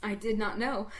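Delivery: plain reading voice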